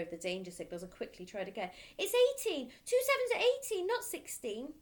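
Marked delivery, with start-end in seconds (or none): 2.0-4.7 much higher, louder, hurried guess; panicky boy. Acted. Frightened boy correcting himself, high and panicky. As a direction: blurt it out in a panic.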